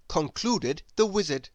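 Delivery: plain reading voice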